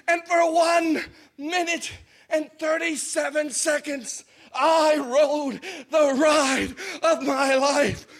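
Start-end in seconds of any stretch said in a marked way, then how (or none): none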